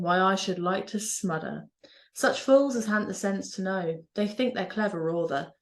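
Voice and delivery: broad Norfolk dialect, slow recital; Norfolk accent. Shown as a demonstration. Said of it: "a poem written in my mother language, the Norfolk dialect".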